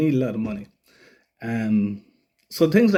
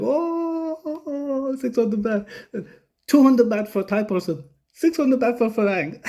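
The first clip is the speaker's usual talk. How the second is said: much higher, laughing Thai-English mimicry; mocking Thai friends; 11 semitones higher, slower than usual. Friends laughing at the tourist price, high mock Thai accent. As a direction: rib the foreigner for overpaying. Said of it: "and everyone starts laughing, like".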